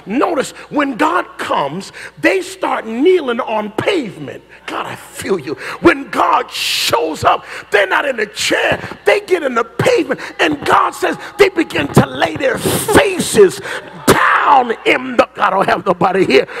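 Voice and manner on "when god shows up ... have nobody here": shouting, high-pitched preacher crying out for fire; fiery preaching. Acted. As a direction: preach it full force, calling down fire. Preacher building to climax.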